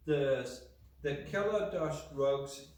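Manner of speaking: ordinary talk, nothing marked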